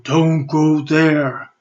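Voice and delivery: slow, booming command from above; godlike voice. Acted. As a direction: speak as a god forbidding, slowly and loud. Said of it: "like a god talking to us".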